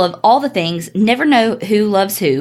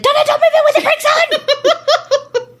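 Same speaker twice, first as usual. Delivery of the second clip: high-pitched scolding shout; mock-scolding mom yell, far above usual pitch; faster than usual. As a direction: yell a warning like an exasperated mom. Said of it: "we call that her mom voice".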